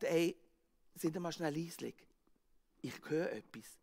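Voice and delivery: quiet everyone down to listen; hushed. Acted. Disciple hushes others.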